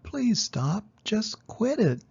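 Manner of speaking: a touch of an exasperated class call-out; lightly voiced fed-up shout; mild hollering. A narrator's reading. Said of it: "the class hollered".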